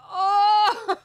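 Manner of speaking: high, loud sob-like oh; real overwhelmed crying exclamation; tearful cry. Real, not acted. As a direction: let the emotion break out. Song story makes her cry.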